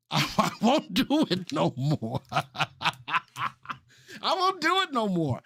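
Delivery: slow, laughing promise. Real, not acted. Host to chat, joking promise through laughter. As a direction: giggle through the vow.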